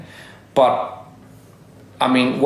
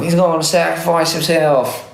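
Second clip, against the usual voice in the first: solemn quoted meme line, a bit higher; meme quote; 4 semitones higher than usual. Retold or imitated. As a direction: repeat the meme line mock-dramatically.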